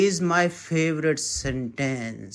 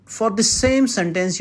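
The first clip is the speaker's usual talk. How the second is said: usual speaking voice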